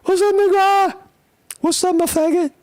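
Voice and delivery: very high, loud falsetto greeting voice; shrill mocking hello in falsetto; mock street greeting. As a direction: hail a buddy in a squeaky high voice. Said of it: "it's like the N-word".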